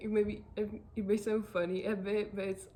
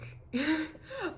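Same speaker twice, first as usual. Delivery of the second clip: teary laugh; 6 semitones higher than usual. Real, not acted. Laughing while retelling crying.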